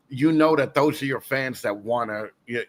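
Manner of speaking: normal talking voice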